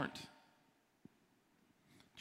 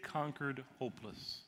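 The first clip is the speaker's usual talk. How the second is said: ordinary delivery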